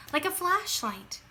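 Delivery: ordinary delivery, nothing marked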